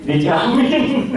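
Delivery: drawn-out laughing "Dijamin", said slow and loud; laughing emphasis. Real, not acted. Preacher joking to the congregation about Tanah Abang, laughing. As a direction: stretch it out through a laugh.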